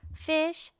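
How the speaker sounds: plain reading voice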